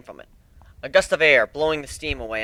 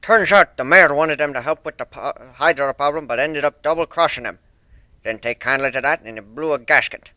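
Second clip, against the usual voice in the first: put-on loud, stern old sailor with rolled R's; stern sea dog accent; 6 dB louder than usual. One-winged Pegasus apologising to Fluttershy, stern thick accent.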